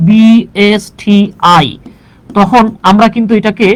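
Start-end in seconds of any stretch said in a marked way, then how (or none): 0.1-1.7 BSTI said slowly, each letter separate; slow, deliberate. Shown as a demonstration. Teacher slowly spelling out BSTI for the class. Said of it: "letter by letter উচ্চারণ করি".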